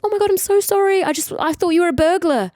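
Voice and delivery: apologetic flatmate. Retold or imitated. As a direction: gush an apology. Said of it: "he was like".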